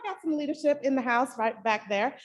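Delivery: ordinary talk, nothing marked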